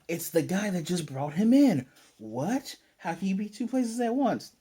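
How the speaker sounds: hammed-up astonishment; mock shock. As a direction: act out baffled villains at a big reveal. Villains shocked at unmasked captive.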